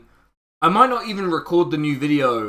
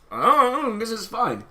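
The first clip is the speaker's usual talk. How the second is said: fake crying; 7 semitones higher than usual. Fake crying over computer.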